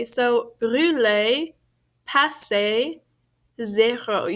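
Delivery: brûler, passer, zéro said slowly, each ending on an open French A sound. Shown as a demonstration. Teacher slowly models brûler, passer, zéro for the class, stressing the A ending. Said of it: "it's always pronounced like A".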